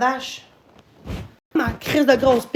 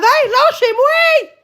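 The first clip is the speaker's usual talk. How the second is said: high, slow stretched cry of leave me alone; drawn-out yell; 10 semitones higher, 5 dB louder, much slower than usual. Real, not acted. Venting at chat viewers, a long high drawn-out yell. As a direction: stretch it out, pleading and fed up. Streamer yelling at harassing viewers.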